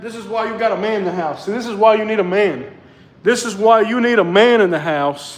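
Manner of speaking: loud, playful scolding; loud teasing. Acted. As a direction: call her out loudly, half joking. Playful argument about house chores.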